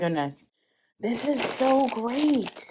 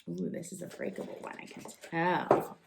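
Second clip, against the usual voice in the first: hushed; 4 semitones lower, 7 dB quieter, faster than usual. Real, not acted. Careful with a breakable package.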